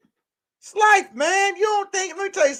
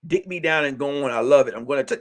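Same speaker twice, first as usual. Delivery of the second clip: much lower, softer sultry imitation; mock woman voice; 11 semitones lower, 4 dB quieter, faster than usual. Comic voicing a woman brushing off a man, low and soft. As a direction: purr it low and lazy like her.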